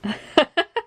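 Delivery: high rising laugh; bright laugh. Real, not acted. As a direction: laugh at your own joke. Laughs at the teasing.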